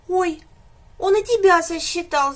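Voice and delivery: high, loud little whine of a frightened calf; whimpering. A narrator's reading. Calf whimpers to the animals, high and loud. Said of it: "заскулил телёнок".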